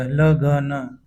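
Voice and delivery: ल ग न sound by sound; full ग. Shown as a demonstration. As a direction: spell out ल ग न as three full syllables. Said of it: "पूरा ग आएगा".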